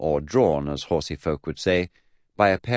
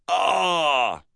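long high cry of pain; drawn-out, falling "aah"; stubbed-toe "ah"; 11 semitones higher, 3 dB louder than usual. Acted. Narrator shows the noise for stubbing a toe, high cry. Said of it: "universal noise for stubbing a toe".

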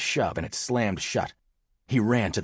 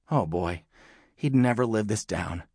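ordinary delivery

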